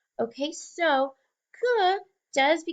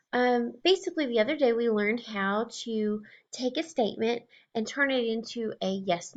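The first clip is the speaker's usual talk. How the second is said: normal talking voice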